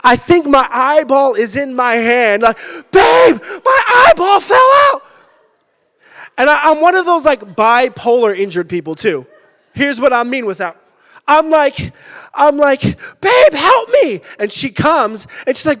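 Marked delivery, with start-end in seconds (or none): 2.9-5.0 high, loud hammed-up scream to his wife; retold screaming. 13.2-14.2 high, loud hammed-up cry for help; mock desperate plea. Retold plea to wife.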